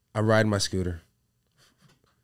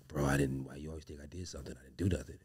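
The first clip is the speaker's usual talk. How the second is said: lower, soft deep-voiced little brother; 7 semitones lower, 14 dB quieter than usual. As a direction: play the brother who sounds like a grandfather. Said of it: "He's like".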